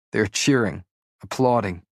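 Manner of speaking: ordinary delivery, nothing marked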